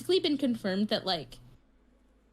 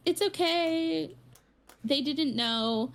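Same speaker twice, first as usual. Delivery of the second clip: slow, higher-pitched soothing voice; gentle consoling dad; 6 semitones higher, slower than usual. Retold or imitated. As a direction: imitate a dad comforting a small child. Dad consoles upset four-year-old in retelling.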